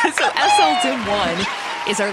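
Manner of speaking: laughing through the username. Real, not acted. Host reading out a new patron's username, laughing.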